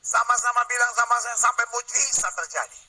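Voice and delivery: high, chant-like rallying call; rousing pulpit declaration; full-force preaching. Real, not acted. Leads congregation in loud repetition.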